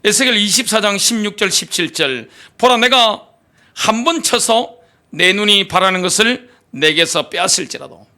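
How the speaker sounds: normal talking voice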